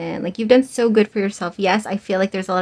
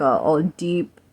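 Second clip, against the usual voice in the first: accidental deep voice; 4 semitones lower than usual. Real, not acted. Tarot reader's voice slips low while describing the roar. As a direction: voice slips lower and rougher on deep. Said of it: "ew, I changed my".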